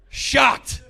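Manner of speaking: a loud mock-outraged Shocked; shouted word.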